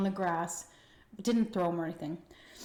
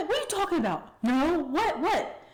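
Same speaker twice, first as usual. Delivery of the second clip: shocked dad; 9 semitones higher, 4 dB louder than usual. Retold or imitated. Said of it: "my dad's like".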